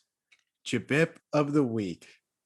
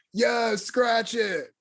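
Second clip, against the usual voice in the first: mock Guido DJ; 9 semitones higher, much slower than usual. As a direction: drag it out like a Jersey Shore DJ. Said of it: "big old Guidos like".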